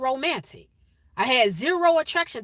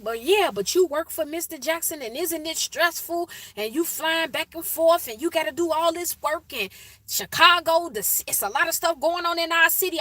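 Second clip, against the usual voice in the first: retelling her own excited babbling at him; excited rambling; 8 semitones higher than usual. Retold or imitated. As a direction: pile on the questions, all wound up. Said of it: "I was just going on and on".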